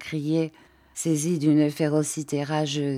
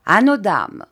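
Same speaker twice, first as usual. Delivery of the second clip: loud raised toast; 4 semitones higher, 7 dB louder than usual. A narrator's reading. Commander toasts the ladies at dinner, loudly. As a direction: call out the toast to the room. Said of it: "Le commandant se leva".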